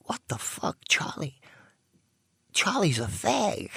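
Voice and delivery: hushed, breathy thought to himself, then rising; muttering a shocked thought under his breath; whispered inner thought. Retold or imitated. Drinking at the club, he thinks it in a whisper. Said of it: "I said to myself".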